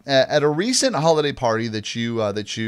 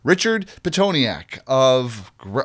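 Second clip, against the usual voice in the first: ordinary delivery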